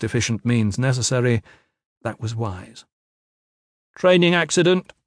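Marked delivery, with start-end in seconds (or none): 3.9-4.9 raised, higher voice, coolly declared; loud and cool. A narrator's reading. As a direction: announce it loudly without remorse. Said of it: "Cruxer said loudly, coolly".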